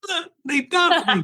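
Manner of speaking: high, whiny "they dumped me"; mock wounded whimper.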